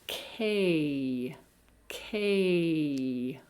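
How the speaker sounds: 'K' with a long drawn-out vowel; slow, elongated 'K'; lengthened vowel. Shown as a demonstration. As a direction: stretch the vowel in 'K', letting it glide down. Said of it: "concentrate on lengthening the vowel sound".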